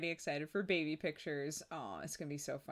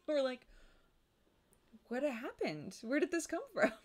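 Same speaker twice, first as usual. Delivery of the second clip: mock surprise; 9 semitones higher than usual. Reacting to baby's crying.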